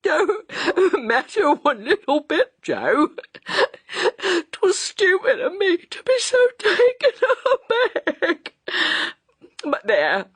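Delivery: hysterical, half sob half laugh; sobbing and laughing. A narrator's reading. Mrs Bunting recovering from the fright, sobbing and laughing. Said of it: "sobbed and laughed by turns".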